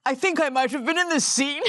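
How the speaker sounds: a lightly voiced posh Frenchman, unsure; mock-polite French gentleman, wondering aloud; a hint of a refined French voice; posh French accent. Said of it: "he's French, but he has very posh".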